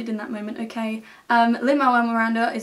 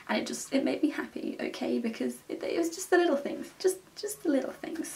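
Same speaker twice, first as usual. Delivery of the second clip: soft gushing; 4 semitones higher, 6 dB quieter than usual. Real, not acted. Gushing over the sound design.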